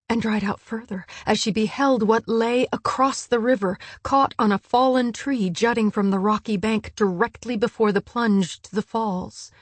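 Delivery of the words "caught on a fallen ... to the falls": ordinary delivery, nothing marked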